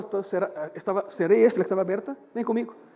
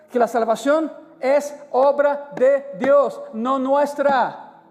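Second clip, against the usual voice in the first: drawn out, each word weighed; slow it down to drive the point home; slow emphatic stress; much slower than usual. Real, not acted. Preacher slowly stressing to the congregation.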